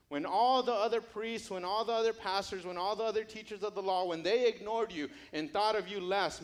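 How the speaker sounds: loud, high, driving repetitions; impassioned preaching. Acted. Jesus telling Matthew how he loved him, impassioned. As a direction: pour it out with fervent conviction.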